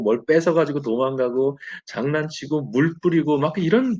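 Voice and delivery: ordinary talk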